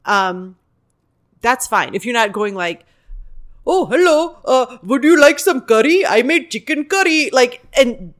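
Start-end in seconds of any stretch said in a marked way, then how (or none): 3.7-7.3 put-on loud, high-pitched caricature of an Indian accent; hammed-up Indian accent played for a laugh. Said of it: "doing the accent to get a laugh".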